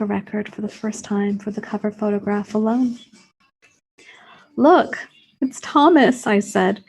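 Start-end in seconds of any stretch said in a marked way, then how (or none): 4.6-6.3 a sudden excited discovery, louder and higher. Acted. Showing Mark the record cover, thrilled and loud. Narrator spots the lookalike record.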